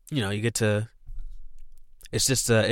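ordinary talk

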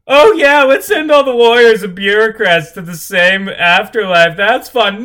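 high mocking sarcasm; sarcastic. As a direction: sneer it in a high voice.

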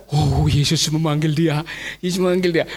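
low, hushed-toned realisation; no longer angry. Acted. Crowd realising Jesus calls him, low awed murmur. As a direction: drop the pitch in mild wonder. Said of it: "Bahasanya bukan marah lagi".

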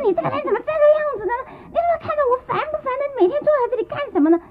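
very high, fast, nagging shrill wife; annoyed wife. Acted. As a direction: rattle off her irritation at him. Wife snaps at staring husband. (潘金莲不耐烦地高声数落武大)

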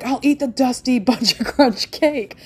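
mock the family happily eating the dusty cake; laughing family voice. Family cheerfully agreeing to eat the failed cake, laughing. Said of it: "They're like".

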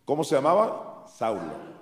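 ordinary talk

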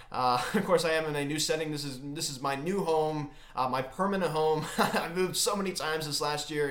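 usual speaking voice

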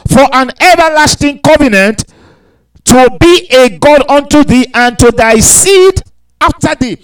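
lifted pulpit reading voice; scripture reading. Acted. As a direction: proclaim the verses to the congregation. Reads verse seven.

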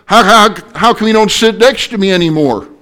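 high, loud, whiny wife voice; wife scolding husband from across the car; complaining wife. Retold or imitated. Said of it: "And she said".

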